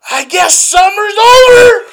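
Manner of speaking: high, drawn-out mock scream; imitated furious outburst. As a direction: holler it like the raging old customer. Acts out customer's furious outburst.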